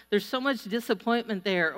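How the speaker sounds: usual speaking voice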